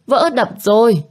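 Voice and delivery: frantic repeat of the warning. A narrator's reading. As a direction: pitch climbing, urgent repeated cry. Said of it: "miệng kêu hét".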